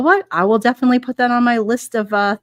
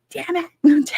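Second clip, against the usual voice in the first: high, laughing mock curse; mock frustration; 7 semitones higher, 3 dB louder than usual. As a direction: swear in playful frustration, half laughing. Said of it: "I was so mad".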